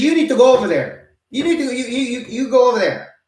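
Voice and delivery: high, loud mock Chinese-accented order; bossy stranger in a put-on accent; mock accent, high and insistent; imitating Chinese accent. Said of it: "And she's Chinese".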